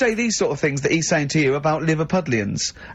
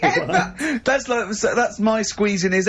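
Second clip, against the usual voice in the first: high laughing shout breaking into words; can't stop laughing at the thumbs joke; 6 semitones higher, slower than usual. Real, not acted.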